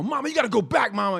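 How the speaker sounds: put-on high, loud kid's plea; kid pleading. Kid begging Mama to return change, high and loud. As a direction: beg like a kid in the back seat.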